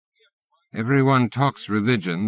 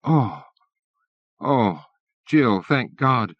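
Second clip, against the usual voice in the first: frightened relief; slower than usual. A narrator's reading. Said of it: "Daniel's frightened voice".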